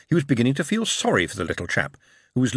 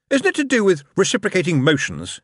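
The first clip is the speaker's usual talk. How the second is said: knowing; 9 semitones higher, 4 dB louder than usual. A narrator's reading. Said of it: "with a glint in his eye".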